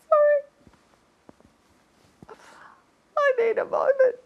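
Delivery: high, choked-up mock grief; overcome with emotion. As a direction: play at being too moved to continue. Overcome by fan's sad story.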